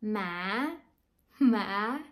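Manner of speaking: "ma" dipping then climbing; fifth Vietnamese tone. Shown as a demonstration. Teacher showing students the fifth tone, dipping and climbing. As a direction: drop "ma" and pull it back up.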